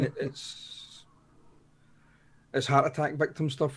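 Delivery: usual speaking voice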